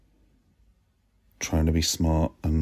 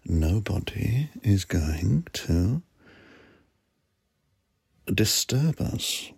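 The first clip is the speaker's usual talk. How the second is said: mock creepy; much slower than usual. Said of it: "talking to you in this slightly weird, scary, um, borderline pervy way".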